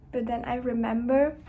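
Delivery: ordinary delivery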